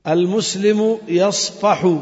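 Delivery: slow "يصفح" with its final u kept; slow full-vowel reading. Shown as a demonstration. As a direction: read slowly and sound every ending. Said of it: "contrast with pausal take". (قراءة بطيئة مع ضم آخر "يصفح")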